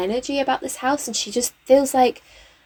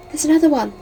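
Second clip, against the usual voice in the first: gasp, then higher excited exclamation; startled; 5 semitones higher, 4 dB louder, faster than usual. Real, not acted. Player spots another ghost mid-sentence, startled. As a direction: gasp and blurt it out in surprise.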